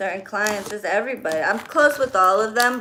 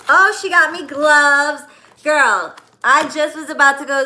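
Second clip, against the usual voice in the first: delighted outburst, louder and higher; excited surprise; 6 semitones higher, 8 dB louder than usual. Real, not acted. Opening a client's gift of gloves, excited surprise. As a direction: exclaim over a welcome gift.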